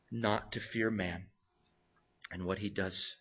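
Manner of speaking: ordinary talk, nothing marked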